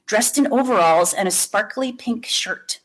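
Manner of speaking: usual speaking voice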